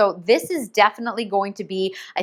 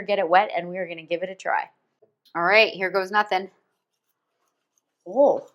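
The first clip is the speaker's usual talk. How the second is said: normal talking voice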